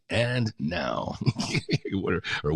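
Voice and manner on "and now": "And now" drawn out as a stand-alone intro; mock radio lead-in on "And now"; radio-announcer AND NOW. Shown as a demonstration. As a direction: hold "And" and let "now" fall, announcer-style. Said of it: "when I see it, it's like".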